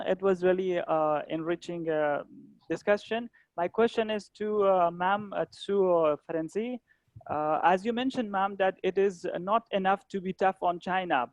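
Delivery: normal talking voice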